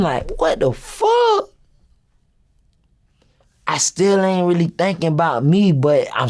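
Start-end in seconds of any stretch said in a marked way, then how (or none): 0.4-1.5 high-pitched, drawn-out disbelief; shocked exclamation. Retold or imitated. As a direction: squeal it slowly in disbelief. Retold shock at the raid.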